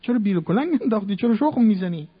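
high, loud, fast indignant yelling; fool shouting. Retold or imitated. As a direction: berate the digger like an outraged fool. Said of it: "یه ابلهی داد زد".

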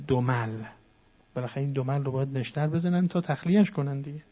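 ordinary delivery

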